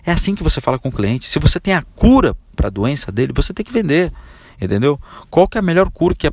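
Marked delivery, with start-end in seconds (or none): none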